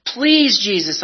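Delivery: high, loud begging cry. Acted. Sinner begging Jesus to spare him from hell, loud. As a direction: beg him with everything. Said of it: "I begged".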